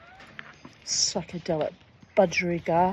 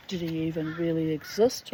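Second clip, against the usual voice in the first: ordinary talk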